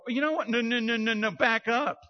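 mock indignant, wave them back; indignant refusal. Preacher refusing release, mock indignant and high. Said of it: "I'm not going to go".

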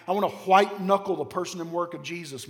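ordinary talk, nothing marked

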